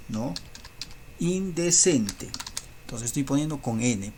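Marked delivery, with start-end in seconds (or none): 1.2-2.3 slow. Shown as a demonstration. Teacher drawing out the answer.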